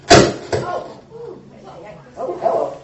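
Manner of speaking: a sudden loud breathy gasp of surprise; startled oh hello. Real, not acted. Pastor startled by something in the room, loud and breathy. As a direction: caught off guard mid-sermon, blurting it out. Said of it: "What in the world did you do?".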